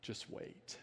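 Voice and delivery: soft unvoiced whisper in someone's ear. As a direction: breathe it quietly and calmly. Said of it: "whispers in my ear".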